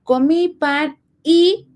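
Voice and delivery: slow, with a lift and a pause on y; slow demo of a simple sentence; slow example. Shown as a demonstration. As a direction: draw the sentence out to show the link word. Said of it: "en las que yo digo".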